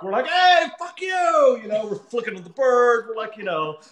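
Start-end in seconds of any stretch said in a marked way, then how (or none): 0.3-1.6 high, drawn-out street yell; mock angry shout. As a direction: holler back like rowdy New Yorkers. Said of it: "someone is yelling out the window at us".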